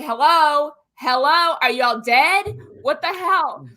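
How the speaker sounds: worried calling out. Retold or imitated. Texting worried friends, calling out.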